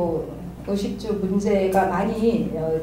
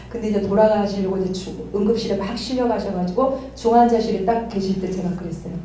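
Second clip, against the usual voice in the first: usual speaking voice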